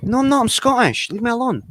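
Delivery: a hammed-up protest at being called Irish; indignant retort. Streamer mimicking his own huffy reply to trolls, high and indignant.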